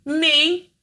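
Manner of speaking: put-on high, small child's answer; a little girl's eager reply. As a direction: answer like a tiny girl. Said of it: "My dad always said to me".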